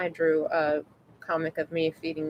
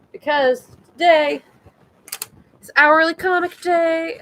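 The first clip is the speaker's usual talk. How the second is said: excited; 9 semitones higher, 8 dB louder than usual. Real, not acted. Excited announcement to viewers.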